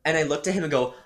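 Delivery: normal talking voice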